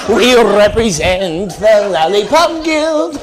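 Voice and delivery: loud, high munchkin chant, drawn out slowly; hammed-up high-pitched little munchkin voice; Lollipop Guild. Comic unable to resist mocking a wheelchair, munchkin impression. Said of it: "I just wanna do this shit".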